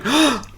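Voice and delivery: high sharp mock gasp. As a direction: gasp in fake shock. Mock shock at Titanic sinking.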